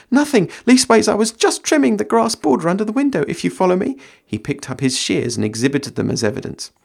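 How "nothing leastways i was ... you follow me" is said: high, louder flustered country lad; Sam voice. Acted. Sam caught eavesdropping pleading with Gandalf, high and loud. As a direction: pitch it up like a caught, nervous gardener. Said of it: "said Sam".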